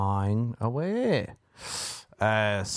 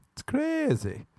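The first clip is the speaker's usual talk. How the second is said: "It's crazy" jumped much higher, squeaked; slower than usual. Pretend. Repeats the line higher.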